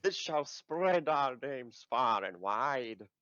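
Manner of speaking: slow, grandly drawn-out heroic declaration. Acted. Adventurer vowing fame from the quest scroll, heroic. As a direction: proclaim it slowly like a legend in the making. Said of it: "the stereotypical heroic".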